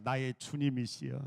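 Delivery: low, slow, reverent greeting; 공경하는 인사. Shown as a demonstration. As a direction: greet them slowly as your lord. 목사가 남편 맞는 인사 시범. (낮고 느리게 공경하는 인사)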